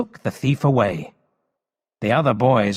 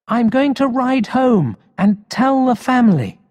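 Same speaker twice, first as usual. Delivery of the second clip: put-on high, louder girl's voice calling out; 12 semitones higher, 4 dB louder than usual. Polly calling to Emil as she rides off, high and bright. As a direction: call it out like an excited girl.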